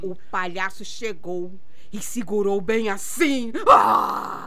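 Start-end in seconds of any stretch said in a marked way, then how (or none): 3.7-4.5 startling shout. Acted. Aunt startles nephew grabbing hand.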